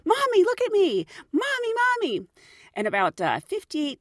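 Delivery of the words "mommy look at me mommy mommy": put-on much higher, calling child voice; whiny kid shouting. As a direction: pester mom like a hyper kid.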